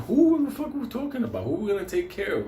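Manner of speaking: asking his friend who they're after; incredulous yell. Retold or imitated. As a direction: shriek in confusion. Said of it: "arguing with friend".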